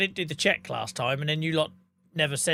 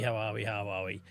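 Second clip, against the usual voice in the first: much lower, softer, trailing off; fading mutter; 7 semitones lower, 8 dB quieter than usual. Shown as a demonstration. Greeting chat again, voice fading to a murmur. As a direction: let it die away to almost nothing. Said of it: "repeats greeting, fading away".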